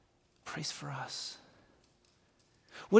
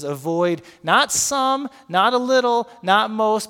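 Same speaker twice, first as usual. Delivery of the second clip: NOT stressed, slow, building louder; emphasis on not; 4 semitones higher, 7 dB louder, slower than usual. Shown as a demonstration. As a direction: lean on each not. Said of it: "it says".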